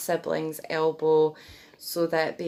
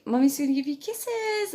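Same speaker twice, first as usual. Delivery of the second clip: much higher sing-song promise; baby talk; 9 semitones higher than usual. Shown as a demonstration. Mum about to kiss her baby, high baby talk. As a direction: coo it playfully to the baby. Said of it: "just emphasize words like".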